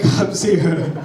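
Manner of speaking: ordinary delivery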